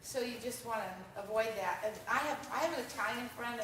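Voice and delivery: usual speaking voice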